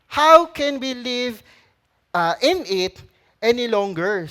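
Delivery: slow, loud, emphatic scripture; stern proclamation of Paul's answer; preaching. Retold or imitated. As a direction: declare it firmly. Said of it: "Sabi niya".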